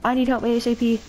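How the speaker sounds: high, held whine of distress; panicked plea. Real, not acted. Gamer asking teammate for urgent help, panicked plea. Pleads for help while dying.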